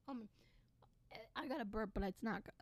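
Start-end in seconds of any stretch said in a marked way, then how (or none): none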